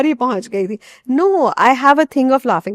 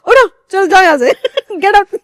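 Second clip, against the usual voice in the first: laughing mock order; 10 semitones higher, 7 dB louder than usual. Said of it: "मैंने कहा".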